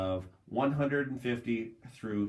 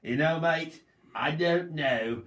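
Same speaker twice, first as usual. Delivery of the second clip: put-on light Australian accent, drawn out; lightly voiced Australian, slow and casual; 3 semitones higher, slower than usual. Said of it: "my Australian accent".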